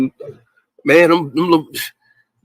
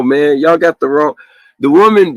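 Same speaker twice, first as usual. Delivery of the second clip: ordinary delivery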